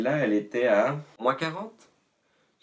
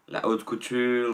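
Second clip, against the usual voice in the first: usual speaking voice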